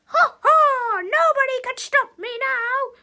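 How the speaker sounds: loud, high megalomaniac shout; hammed-up evil cackle; evil villain. A narrator's reading. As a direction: proclaim it and burst into villain laughter. Villain gloats in comic.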